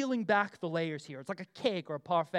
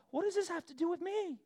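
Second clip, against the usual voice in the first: higher, wry retort with a tone; exasperated son; 10 semitones higher, 4 dB quieter than usual. Retold or imitated. Jesus answering his mother, exasperated and wry. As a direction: push back with a knowing sigh. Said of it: "Jesus' response says it all".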